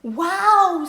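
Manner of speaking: loud, high, drawn-out wow; wowed little girl, high and loud; amazed exclamation. Acted. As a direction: exclaim in big storybook wonder. Nora marvels at the dragonflies.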